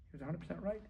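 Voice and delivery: ordinary talk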